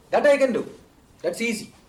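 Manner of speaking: high, emphatic delight, easing off; proudly confident, starting loud; acted eager boy. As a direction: exclaim it like a keen pupil. Boy delighted, line repeated.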